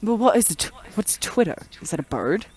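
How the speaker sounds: Renaissance-era British person; puzzled British accent, as if from Renaissance times. Acted. Renaissance Brit discovering Twitter for the first time, British accent. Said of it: "like a Renaissance era British person".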